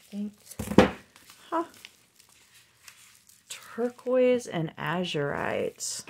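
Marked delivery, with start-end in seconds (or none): none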